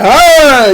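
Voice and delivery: loud high fighter's roar; mock battle roar. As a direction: roar like a video game character. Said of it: "He's like".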